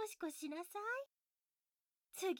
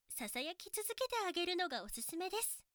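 ordinary talk

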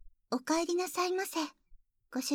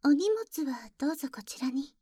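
whispering. Acted.

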